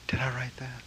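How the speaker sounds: breathy whisper of disbelief; whispered question. Retold or imitated. Master whispering to himself about the text, softly amazed. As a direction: hush it as a wondering aside.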